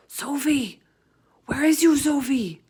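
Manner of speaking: urgent whispered calling. A narrator's reading. The BFG searching for Sophie in the cave, in an urgent whisper. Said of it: "he whispered".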